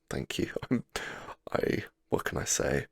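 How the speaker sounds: normal talking voice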